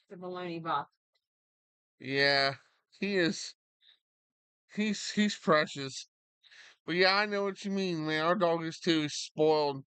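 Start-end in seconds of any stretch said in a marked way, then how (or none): none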